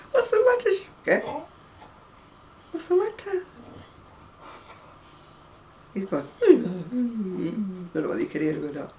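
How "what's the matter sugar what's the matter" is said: high pet-talk coo. Real, not acted. Owner coos at her whining dog Buster, high baby talk.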